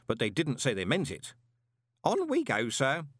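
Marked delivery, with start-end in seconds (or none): 2.0-3.1 put-on high-pitched old postman urging on. A narrator's reading. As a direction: coax him onward keenly. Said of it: "Groat urged".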